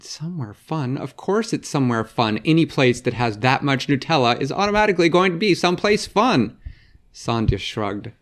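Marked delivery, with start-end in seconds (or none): 0.0-6.6 fast and exasperated, climbing higher to the end; exasperated girl. Acted. Vera huffs at the detective, fast and exasperated. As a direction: rattle it off, fed up with the obvious.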